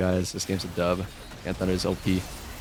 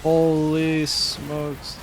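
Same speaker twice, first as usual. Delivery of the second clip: drawn-out amazement; 4 semitones higher, 3 dB louder, much slower than usual. Real, not acted. Streamer stunned by an enemy play, drawn-out slow disbelief. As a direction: stretch holy smokes out long and slow in disbelief.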